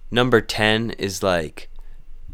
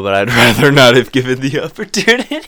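laughing; 6 semitones higher, 10 dB louder than usual. Real, not acted. Laughing through the sentence.

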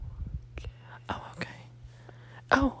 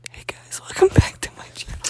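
breathy whispered vlog greeting; whispered YouTuber intro. Pretend. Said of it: "Mystery voice number two".

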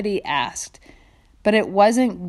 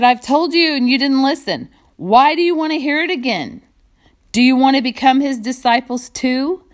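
higher and louder, fed up with the questions; exasperated retort; 5 semitones higher, 4 dB louder than usual. Acted. Healed man snapping back at the Pharisees, higher and louder. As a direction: throw it back at them, annoyed.

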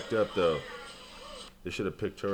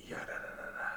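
whispered scat; 14 dB quieter, faster than usual. Pretend.

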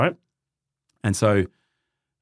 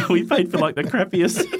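laughing; 8 semitones higher than usual. Real, not acted. Laughing at cheap booking.

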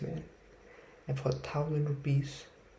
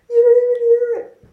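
shrieked high and very loud; screaming punchline; 20 dB louder than usual. Acted. Comic bit for his TikTok, screamed punchline. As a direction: blast the punchline like a comic yelling. Trying to perform comedian's bit.

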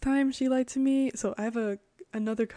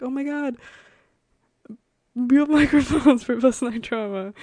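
higher, much louder laughing delight; thrilled exclaim; 4 semitones higher, 10 dB louder than usual. Retold or imitated. Friend delighted by real microphones, loud laughing excitement. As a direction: burst out with joy through a laugh. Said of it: "she was so happy".

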